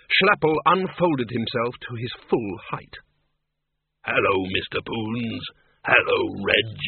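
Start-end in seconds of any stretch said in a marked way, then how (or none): 4.0-6.8 low, slow genial monster voice; a big hairy creature, easygoing and kind; friendly bogeyman. A narrator's reading. The bogeyman greets old friends in a low friendly voice.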